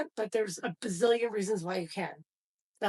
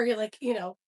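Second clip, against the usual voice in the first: normal talking voice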